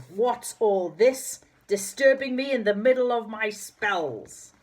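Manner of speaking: slow, loud, high booming wizard. A narrator's reading. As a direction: boom it out like a grumpy spell maker. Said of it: "A very loud voice boomed out loudly".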